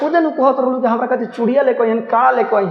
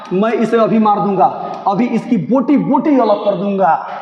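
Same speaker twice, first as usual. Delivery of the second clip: angry threat. Acted. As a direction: shout the threat at the enemy. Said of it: "मानक कह रहा है". (गुस्से में चिल्लाकर धमकी)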